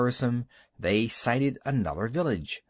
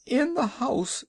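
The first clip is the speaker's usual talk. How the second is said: tearful; 13 semitones higher, slower than usual. A narrator's reading. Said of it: "bursting into tears".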